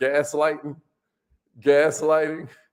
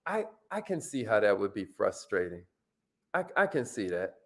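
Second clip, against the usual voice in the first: soft, sincere and much quieter; gentle sympathy; 9 semitones lower, 8 dB quieter than usual. Shown as a demonstration. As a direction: say it gently, like you mean it.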